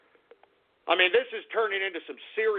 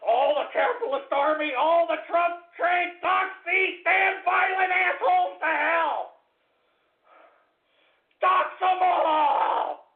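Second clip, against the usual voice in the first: shouting rally cry; 7 semitones higher than usual. Real, not acted. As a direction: bellow orders to the troops.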